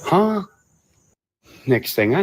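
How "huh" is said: sharp high baffled huh; puzzled, go way up; surprised huh. Real, not acted. Hearing the odd quote, a baffled huh.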